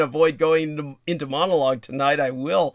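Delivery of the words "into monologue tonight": ordinary talk, nothing marked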